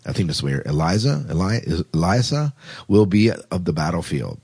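ordinary delivery

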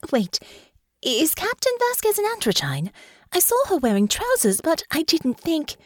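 high, bewildered questioning; astonished, trailing off. A narrator's reading. Honor realizes about Captain Vasquez, bewildered. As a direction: puzzle it out high, then leave it hanging. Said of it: "she trailed off".

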